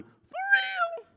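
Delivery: high falsetto for real; mock God answering in a squeak; squeaky God reply. God answering the son in a high squeak. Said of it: "Yes, son".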